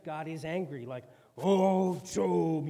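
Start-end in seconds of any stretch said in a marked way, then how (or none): 1.4-2.7 much louder, booming wrathful God; booming movie God. Retold or imitated. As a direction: thunder it like a film deity. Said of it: "that whole movie-like thing".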